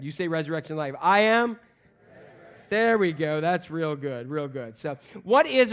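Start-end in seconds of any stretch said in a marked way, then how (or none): 1.0-1.6 loud, higher, leading the crowd; call-and-response. Shown as a demonstration. Pastor prompting the congregation to answer, loud call. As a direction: prompt them loudly to answer. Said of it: "let's try this again".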